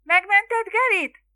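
high, loud, drawn-out call; blaring cry. A narrator's reading. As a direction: holler from behind a crowd. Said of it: "harsogta mama a tömeg háta mögül". (harsogó, magas, elnyújtott kiáltás)

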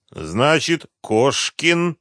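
slow, pondering. A narrator's reading. Colonel tries out the suspect's surname, speaking slowly. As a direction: taste the surname as you say it. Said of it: "медленно проговорил Демидов, словно пробуя".

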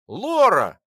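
loud happy shout; joyful. Acted. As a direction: call out her name with delight. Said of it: "обрадовался я".

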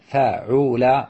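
فاعول as drawn fa-'u-la; middle vowel U. Shown as a demonstration. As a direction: sound out فاعول with a U after the ayn. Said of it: "بضم".

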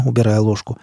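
plain reading voice

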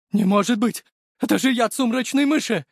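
high, excited exclamations; astonished disbelief. A narrator's reading. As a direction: gape at an impossible survival. Killer amazed the victim lives. (изумление высоким голосом)

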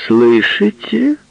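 high, loud, slow call out. Acted. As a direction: call the whole bar. Calling to whole bar.

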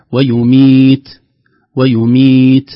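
loud, chanted ويميت with a light puff on ت; whispered final ta. Shown as a demonstration. Teacher models the slight breath after ta, whispered ending. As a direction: end ويميت on a small release of air at the ت. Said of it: "وهو خروج هواء يسير في مخرج الحرف".

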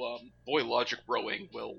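ordinary delivery

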